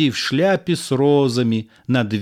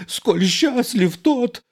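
gasping; 11 semitones higher, slower than usual. Acted. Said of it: "задыхаясь".

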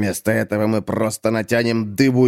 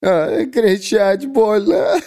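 high, slow moaning in pain; pained poet; 11 semitones higher, much slower than usual. Acted. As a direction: moan because yelling hurts. (стонать от боли высоким голосом)

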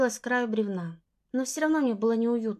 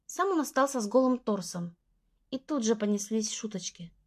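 plain reading voice

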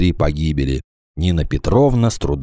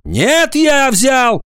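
high, forceful shouted confession; high-pitched emphatic interjection; interrupting; 4 dB louder, much slower than usual. A narrator's reading. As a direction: cut in loudly and insist. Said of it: "перебил его Агафонов".

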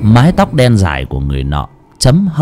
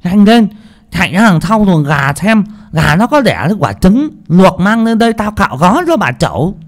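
put-on old cook voice, higher and bossy; 9 semitones higher than usual. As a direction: hurry the servants like a fussy cook. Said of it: "Bà bếp nói".

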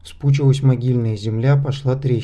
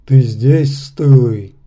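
louder, booming stone giant; 6 dB louder, much slower than usual. A narrator's reading. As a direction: call out like a waking rock giant. Stone giant speaks on waking. (голос каменного великана)

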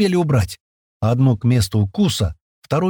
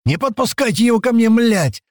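very high, horrified yell; frantic screaming; 12 semitones higher than usual. Acted. Паштет screams at his mates in horror, frantic. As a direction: scream to keep him away. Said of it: "завопил во всю глотку Паштет".

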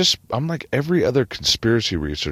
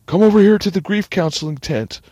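raised, higher acted voice; calling over; 7 dB louder than usual. Responder ushers Lucky to grief counseling, calling over. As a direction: beckon loudly, as if over commotion. Responder calls survivor to tent.

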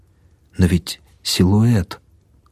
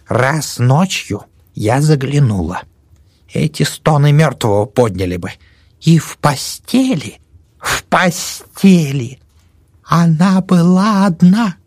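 put-on old woman voice; 7 semitones higher, slower than usual. Old woman tells scary tale.

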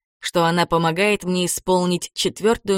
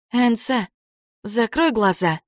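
usual speaking voice

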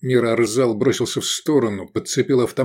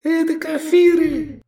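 very high, drawn-out shriek; piercing cry of alarm; alarmed shout; 4 dB louder than usual. A narrator's reading. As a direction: scream the warning at the top of the range. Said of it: "крикнул Шавкат".

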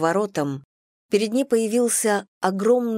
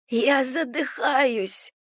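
high strangled gasp, slow; wind knocked out; 6 semitones higher, much slower than usual. A narrator's reading. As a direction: choke out the words, out of air. Joan being choked.

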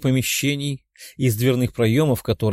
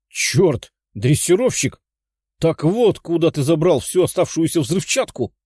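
higher excited outburst; exclaiming; 5 semitones higher than usual. Acted. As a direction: blurt it out in sudden realization. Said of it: "воскликнул комар".

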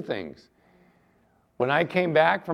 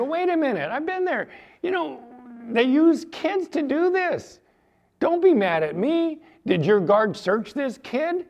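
high, pleading protest; defensive protest; 8 semitones higher than usual. Retold or imitated. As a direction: argue your case to the officer. Narrator defending himself to lieutenant.

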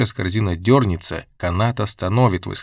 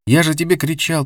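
higher-pitched scolding of a friend; mildly exasperated, pitched up; raised reproach; 7 semitones higher than usual. A narrator's reading. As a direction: voice Казик's indignant complaint, not a full shout. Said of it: "повторял Казик".

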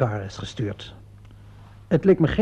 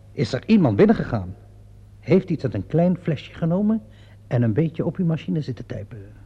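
ordinary talk, nothing marked

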